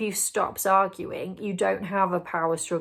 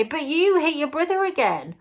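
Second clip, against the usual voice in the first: much higher, accusing parent; mock frustrated parent scolding; exasperated scolding; 8 semitones higher, 3 dB louder than usual. Shown as a demonstration. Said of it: "You're not gonna say".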